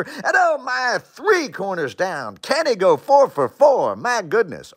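rising, thrilled sportscaster call; excited play-by-play. Shown as a demonstration. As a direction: get hyped over nothing. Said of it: "Get excited over nothing much".

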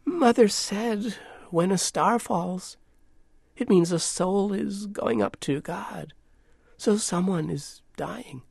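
put-on remembering little girl. A narrator's reading. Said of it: "she remembered".

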